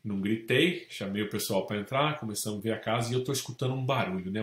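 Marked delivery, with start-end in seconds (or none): none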